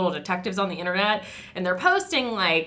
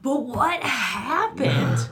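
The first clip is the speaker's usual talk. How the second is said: very slow, higher, baffled question; drawn-out bafflement; 4 semitones higher, much slower than usual. Retold or imitated. Storyteller puzzled by dumped dogs story, drawn-out bafflement. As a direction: stretch out the question in exaggerated puzzlement.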